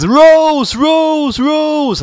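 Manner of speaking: high, wailed name calls; screamed. A narrator's reading. Doctor Who screams for Rose, high-pitched cries. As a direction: cry out the name high, each one falling. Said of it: "Doctor Who screamed".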